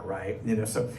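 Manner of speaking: ordinary talk, nothing marked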